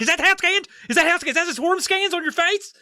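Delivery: very high squeaky mock indignation; high mock voice, outraged; mock indignant. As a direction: play it as a shrill cartoon complaint. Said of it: "I'm like".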